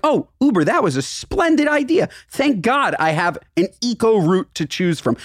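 high sarcastic gushing; mock posh praise. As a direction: gush like a smug fan. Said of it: "they're like".

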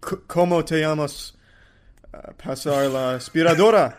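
luchador-style wrestler intro in Spanish; booming wrestler boasts. Pretend. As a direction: boom it out like a Mexican wrestler announcing himself. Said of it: "you haven't done your W W E intro".

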